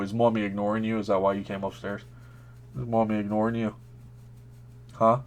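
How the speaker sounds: soft, quiet pet talk; talking to a dog. Real, not acted. Greeting and praising the dog.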